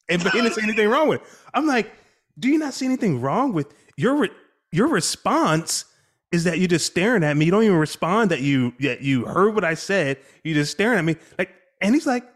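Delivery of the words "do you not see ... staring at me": higher, louder scolding; exasperated lecture. Retold or imitated. Father scolds son for staring silently, exasperated. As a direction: scold him for just staring, worked up.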